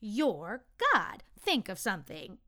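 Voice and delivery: emphatic, perky urging; perked up. A narrator's reading. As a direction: urge them on with a perky push. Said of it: "the little soul perked up".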